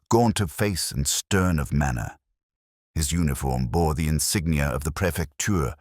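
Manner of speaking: plain reading voice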